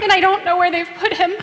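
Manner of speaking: high, loud, desperate plea; anguished cry. Acted. Mary crying out to the angels about her Lord. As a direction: cry it out as if your Lord was stolen.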